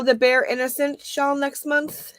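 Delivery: ordinary talk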